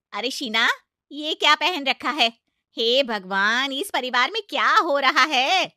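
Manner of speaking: loud, scandalized outcry; aunt loudly shocked at the bride's suit; shocked outburst. Acted.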